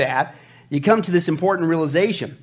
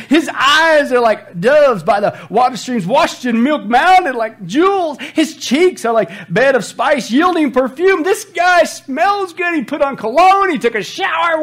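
loud, high, hammed-up rapture; impassioned scripture reading; 9 semitones higher, 7 dB louder, slower than usual. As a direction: build from declaimed verse to excited hollering. Reads poem, adds excited comments.

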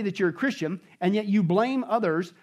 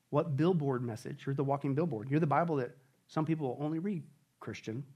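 much softer, low, earnest; hushed appeal; 5 semitones lower, 7 dB quieter than usual. Real, not acted. Pastor pleading softly with the congregation, hushed. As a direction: lean in and plead quietly.

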